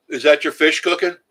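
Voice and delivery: calling to his wife. Real, not acted. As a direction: raise the voice so she hears from the kitchen.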